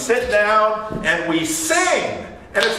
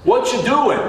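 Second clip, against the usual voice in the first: imitated New Jersey accent, pitched higher; Ronnie; 4 semitones higher than usual. Lumber coworker questions someone praying, New Jersey accent. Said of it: "in his thick New Jersey accent".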